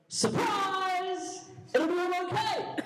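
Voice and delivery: high-pitched, held-back mock yell. Boy planning to pounce from the leaves, mock yell. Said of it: "I yell".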